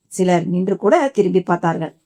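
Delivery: ordinary delivery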